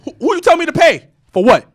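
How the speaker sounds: imagined challenge, high and loud; indignant retort. Retold or imitated. Clubgoer silently challenging the door woman, indignant. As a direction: puff up in mock outrage at being charged. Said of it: "in my head, I'm saying that".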